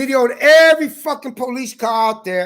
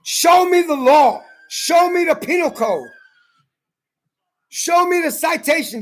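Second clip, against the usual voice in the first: high-pitched insistent courtroom challenge; insistent demand; 5 semitones higher than usual. Retold or imitated. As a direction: press each demand in a raised pitch. Retelling his argument to the judge.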